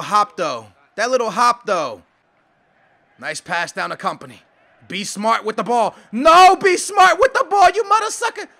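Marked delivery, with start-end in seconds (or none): 6.1-8.5 loud, high scolding; exasperated shout. Real, not acted. Gamer scolds own player for risking the ball, exasperated shout. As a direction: yell it at a careless player.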